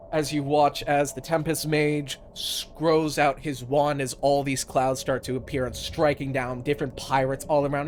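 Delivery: ordinary delivery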